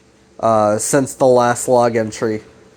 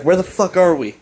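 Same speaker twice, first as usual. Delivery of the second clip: higher-pitched, imitated confused outburst; mock bewilderment; 6 semitones higher than usual. Alex to Chris on waking, mock bewildered. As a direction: act out a disoriented demand.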